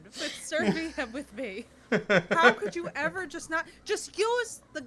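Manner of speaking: loud, building comic fury; seethe, then explode; rage. Acted. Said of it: "I can hear the rage".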